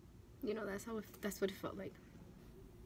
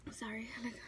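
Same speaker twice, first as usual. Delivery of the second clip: choked-up apology; 7 dB quieter, faster than usual. Real, not acted. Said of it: "I do not wanna get emotional".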